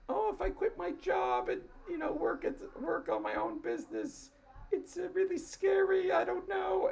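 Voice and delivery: high, whiny mock voice full of fear; mock their nervous dithering in a high squeak; scared wannabe founder. Host mocking timid people afraid to quit their jobs, in a high whiny voice.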